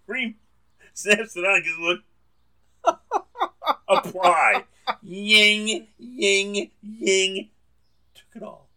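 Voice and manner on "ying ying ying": high, sung-out clipper noise; playful ying ying ying buzzing; clipper buzz imitation. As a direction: mimic trimmers buzzing through hair.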